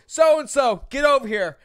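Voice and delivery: big summoning shout, imitated; mock calling over. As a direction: holler for someone across the bar. Imitates bouncer calling girl over.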